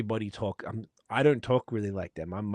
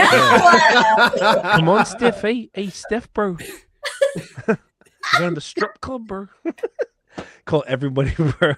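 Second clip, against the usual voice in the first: put-on much higher, louder laughing Kiwi accent; 10 semitones higher, 11 dB louder, slower than usual. Imitating the Kiwi guy calling friends to the strip club. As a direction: shout it like a hyped Kiwi mate. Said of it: "we ever stopped saying it".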